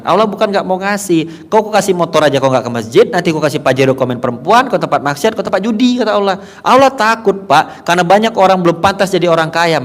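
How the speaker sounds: high, loud fervent preaching; preaching at full force. Acted. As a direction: drive the point home to the congregation.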